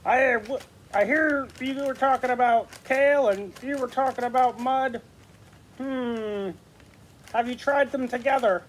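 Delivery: high, cheerful puppet character. Acted. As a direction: pitch it up for a chatty puppet. New puppet joins the conversation.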